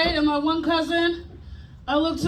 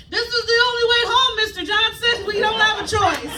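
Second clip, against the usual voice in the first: raised, high-pitched retort; exasperated yell in a higher pitch; mom yelling back; 6 semitones higher than usual. Retold or imitated. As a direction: call it back across to a neighbor. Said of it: "My mom yelled back one day".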